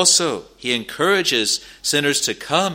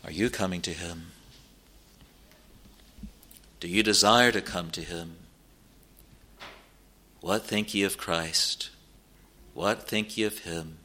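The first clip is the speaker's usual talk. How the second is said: low, soft, searching questions; quiet questions with long pauses; hushed pulpit appeal; 4 semitones lower, 7 dB quieter than usual. Real, not acted. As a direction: drop down and ask it gently.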